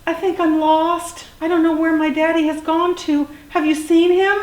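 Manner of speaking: high, pleading youngster voice; lost little dinosaur. A narrator's reading. Little dinosaur asks a stranger for help, high pleading.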